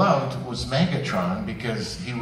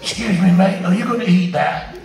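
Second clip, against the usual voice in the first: higher and louder, cheeky little critter; pond creature impression; 8 semitones higher, 9 dB louder than usual. Shown as a demonstration. A duo doing a forest pond impression, comic and perky. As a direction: pipe up like a pushy pond creature. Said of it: "This is our impression of a pond in the forest".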